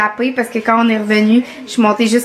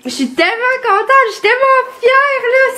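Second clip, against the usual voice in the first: excited; 12 semitones higher than usual. Real, not acted. Proud of the dog.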